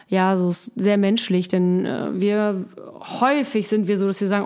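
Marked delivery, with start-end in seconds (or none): none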